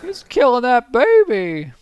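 high, loud, slow alarmed cry; loud, high Jimmy Stewart impression. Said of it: "Jimmy Stewart-y".

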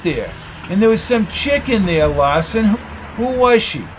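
a touch of heated accusation; accusing interrogator. Acted.

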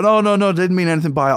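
high, hasty mock pleading; imitated panicky apology; acted backpedalling. Dutch guy backing down from threat.

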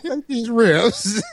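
very slow, playing the generous rich man; hammed-up slow magnanimity; drawn-out mock offer. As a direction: stretch it out slowly like a lordly favor. Mock generous boss offering leftovers.

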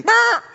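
loud, very high chicken squawk; short piercing squawk. Retold or imitated. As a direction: imitate a startled chicken at full volume. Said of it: "She did the head thing".

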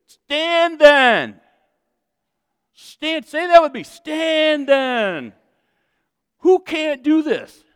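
high, loud preacher's call-out; rally the crowd to shout along. Real, not acted. Preacher urging congregation to stand, rallying call.

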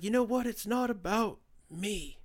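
slow, drawn-out mock Trump; Trump impression. Mocking Trump at his rally, slow and drawled. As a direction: ham up the humble-bragging president. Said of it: "he's like".

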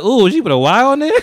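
high, loud laughing surprise; mock surprise. Host retelling reading the name, high laughing surprise. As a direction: act shocked by the spelling. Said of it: "I was like".

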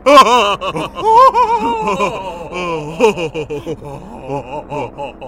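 loud high cackle; burst of laughter. Real, not acted. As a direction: crack up at the joke.

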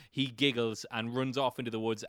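ordinary delivery

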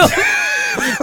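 high squeal of a laugh; real squealing laugh; shrieking laugh. Real, not acted. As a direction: let a laugh break up into a squeal. Bursts out laughing.

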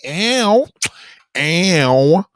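loud, high "ow" catcall; big flirty howl, drawn out; catcall with personality. Shown as a demonstration. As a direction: belt out "ow" with full swagger. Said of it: "you gotta do it like me".

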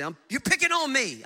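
high-pitched put-on complaint; hammed-up objection; mock protest. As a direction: play an aggrieved listener, pitched high. Voices a complaining listener, answers.

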